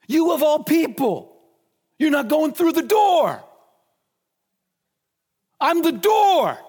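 preacher voicing Jesus rebuking the Pharisees, shouting. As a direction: thunder it at them like a prophet.

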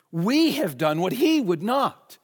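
«We» выделено, фраза медленно и выше; ударение на «We», противопоставление «he»; stress on we. Shown as a demonstration.

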